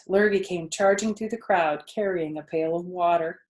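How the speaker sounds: ordinary delivery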